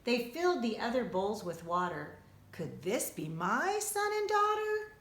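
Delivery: ordinary delivery, nothing marked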